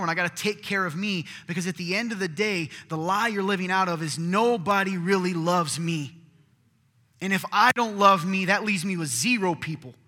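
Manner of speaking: building, loud pulpit delivery rising to a near-shout; full-force preaching. Acted. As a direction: drive it home to the congregation.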